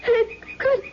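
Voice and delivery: frightened cry. Acted. Wife recognizing the stranger at the door, frightened cry. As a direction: gasp it out as the horror dawns. Recognizes the husband she thought dead.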